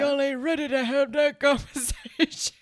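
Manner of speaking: lightly voiced old man, half laughing; hint of a comic old man; mock old man. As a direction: imitate an old man's catchphrase. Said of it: "like, an old man is just like".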